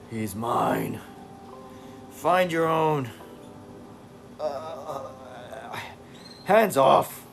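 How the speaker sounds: other voices, rising to a shout on hands off; squabbling inner voices. Acted. Egos fighting over David, building to a shouted hands off. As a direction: snarl like rival egos claiming him.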